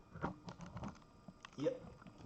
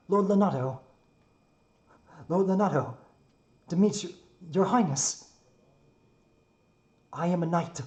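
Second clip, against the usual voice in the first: earnest knight impression, raised and loud; Ash; 7 semitones higher, 9 dB louder than usual. Shown as a demonstration. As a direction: proclaim formally like a loyal knight. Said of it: "I think I'm losing touch of my Ash".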